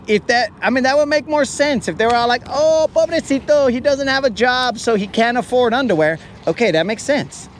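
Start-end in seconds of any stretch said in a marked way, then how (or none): 2.5-6.2 higher, cooing nurse voice; mock pity, pitched up. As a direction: coo it like a sorry nurse. Nurses voiced pitying him.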